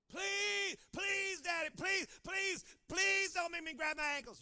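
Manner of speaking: put-on high, loud pleading child; child begging his daddy, loud and high. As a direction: whine and beg like a small kid.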